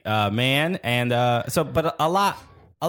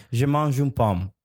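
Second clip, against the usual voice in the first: "Je mange une pomme" said slowly in a careful French accent; French phrase. Shown as a demonstration. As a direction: show off schoolbook French, slowly. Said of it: "All I can say is".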